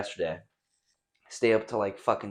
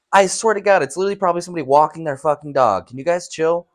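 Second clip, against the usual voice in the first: louder, higher, fast pleading; fed up, telling them to chill; exasperated; 8 semitones higher, 9 dB louder, faster than usual. Real, not acted. Scolding the dogs over a passerby, loud exasperated.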